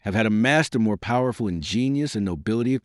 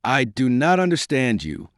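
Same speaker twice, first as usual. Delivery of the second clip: ordinary delivery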